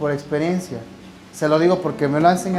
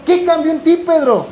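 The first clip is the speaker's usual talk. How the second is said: amazed question; 8 semitones higher, 3 dB louder than usual. Acted. Preacher voicing question to Peter.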